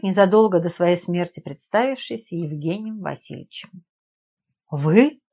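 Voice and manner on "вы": sudden louder blurt. A narrator's reading. Nadezhda to a man she thought dead, blurted out. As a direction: blurt it out in startled disbelief. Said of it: "выпалила Надежда".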